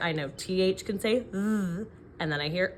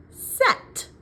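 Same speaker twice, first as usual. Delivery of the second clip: "Set" said high and loud; emphatic syllable; 8 dB louder than usual. Shown as a demonstration. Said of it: "What's the second syllable?".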